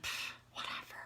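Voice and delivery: breathy whispered scoff; mock eye-roll whisper; dismissive whatever. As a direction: brush it off with a whispered pff. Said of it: "I was like".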